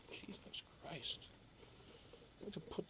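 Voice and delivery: whispered exasperation. Real, not acted. Fed-up streamer whispering to himself off to the side. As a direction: sigh it out under your breath. Exasperated aside after the yelling.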